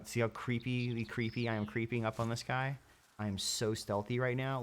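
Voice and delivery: slowed right down, creeping stealthily. Pretend. Gamer narrating his slow sneak, stretched-out speech. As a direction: draw each word out like moving in slow motion. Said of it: "Look, I'm going in slow motion".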